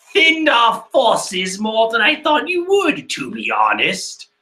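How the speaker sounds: slow, put-on villain voice; Perrowan. Villain remarking on thinned forces, slow affected voice. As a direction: play a drawling manga villain. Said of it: "Oh, I like that".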